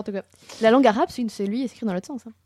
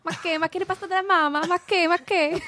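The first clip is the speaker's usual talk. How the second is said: hammed-up Italian accent, high, far off her usual voice; mock Italian accent; 8 semitones higher, 4 dB louder than usual. Asked for an Italian accent, she hams one up. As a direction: ham up a cartoon Italian accent, laughing in. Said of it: "l'accent italien".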